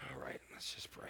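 soft breathy whisper before prayer. Real, not acted. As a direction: lean in and whisper it.

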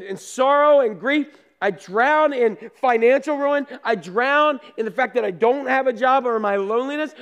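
desperate complaint to God. Acted. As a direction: beg God to wake up and care. Desperate prayer to sleeping Jesus.

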